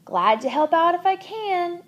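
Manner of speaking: squeaky hamster. A narrator's reading. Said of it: "I squeaked to her".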